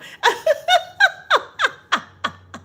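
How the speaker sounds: big shrieking high laugh; loud laughter. Real, not acted. Cracking up at a viewer's joke, loud laugh. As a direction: burst out laughing, real and loud. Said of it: "you got me laughing loud".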